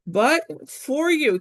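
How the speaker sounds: "but for you" drawn out slowly; slow translation. Shown as a demonstration. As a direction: spell out the meaning slowly for the class. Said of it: "Tibi is a nice dative there".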